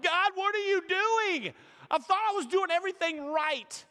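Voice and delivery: a believer in small pain whining high at God; whiny complaint to God. Retold or imitated. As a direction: cry out to God like a hurt child.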